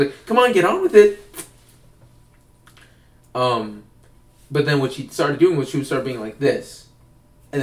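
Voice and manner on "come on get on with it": loud, high, exasperated hurry-up; annoyed improv teacher, higher and louder; impatient teacher. Retold or imitated. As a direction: snap at him to wrap it up.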